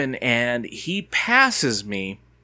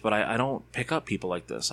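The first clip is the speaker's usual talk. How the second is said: ordinary delivery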